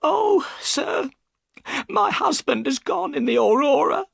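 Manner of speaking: high, tearful wail; worried wife breaking into tears; bursting into tears. Acted. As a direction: cry out the news about her husband. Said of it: "and burst into tears".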